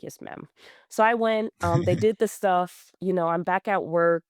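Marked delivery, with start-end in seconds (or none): 0.0-0.5 hushed, much softer, dutiful reply; meek yes ma'am. Retold or imitated. Telling mom she will go to the doctor, meek and hushed. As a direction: give in to mom quietly, like a scolded kid. Meekly obeying her mom's order.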